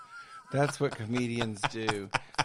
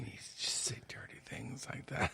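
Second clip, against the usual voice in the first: whispering; 10 dB quieter than usual. Real, not acted. Whispering about dirty talk.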